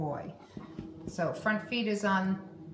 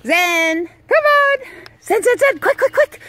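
excited calling; 12 dB louder than usual. Real, not acted. Hyping dog in play.

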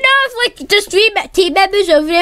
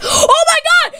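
high, slow gasping disbelief; shocked gasp; 8 semitones higher, slower than usual. Real, not acted. Enemy team spotted at base.

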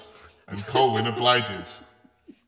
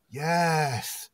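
higher, drawn-out acted yes; hammed-up mock ecstasy on one word; near climax; 5 semitones higher than usual. Colwyn answers the magician, mock ecstatic. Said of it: "seconds from release".

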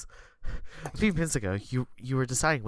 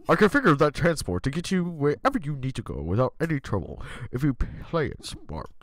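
officer voice. Acted. GM voicing Officer Sanders.

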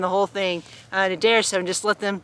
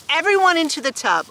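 mild command, pitched higher; storybook order; 7 semitones higher than usual. A narrator's reading. Mister Stupid sending everyone to bathe, ordering mildly. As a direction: give a playful order to the family. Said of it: "ordered Mister Stupid".

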